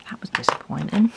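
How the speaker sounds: hushed letdown whisper. Real, not acted. Reviewer reacting to the champion pen failing, whispered. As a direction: breathe your disappointment.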